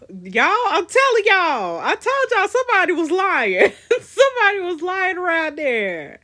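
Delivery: loud, high I-told-you-so wail; vindicated outburst. Real, not acted. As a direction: crow to the audience that you called it. Vindicated outburst at player reveal.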